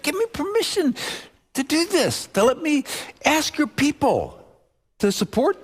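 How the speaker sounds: high, pressing plea to the pastor; urgent pleading request; earnest plea. Retold or imitated.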